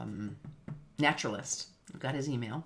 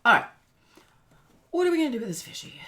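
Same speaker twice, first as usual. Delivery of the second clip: much higher, fast and louder; high playful voice; 9 semitones higher, 13 dB louder, much faster than usual. Pretend. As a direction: squeal it like talking to a pet. Playful question to fish.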